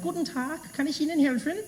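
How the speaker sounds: friendly shop woman. Retold or imitated. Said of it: "She was like".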